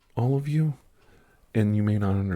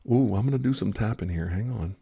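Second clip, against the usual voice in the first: ordinary delivery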